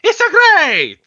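very high, loud, cartoonish Italian plumber cry; Mario voice. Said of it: "have the voice of Mario".

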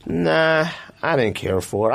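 ordinary talk

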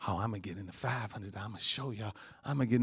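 low, soft mock boasting; hushed, self-important swagger; mock showing off. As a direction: play a show-off bragging under the breath. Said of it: "Showing off".

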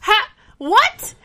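high, slow astonished blurt; stunned disbelief, drawn out; hammed-up amazement; mock shock. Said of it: "Cass is like".

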